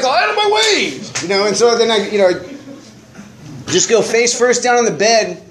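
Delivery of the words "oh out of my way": high mock yell; retold drunk shoving past his girlfriend's mom, high mock yell. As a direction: bark it out like a bloodied drunk barging in. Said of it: "and I'm just like".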